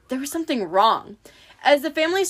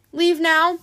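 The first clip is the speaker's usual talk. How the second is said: whimpering; 6 semitones higher, 4 dB louder than usual. A narrator's reading. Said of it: "Tyson whimpered".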